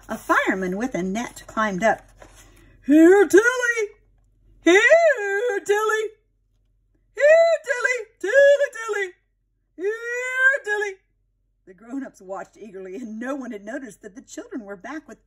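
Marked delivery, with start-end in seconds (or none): 2.9-10.9 high, loud repeated calls to the bird; calling out. Acted. Fireman on the ladder calling Tilly, high loud coaxing calls.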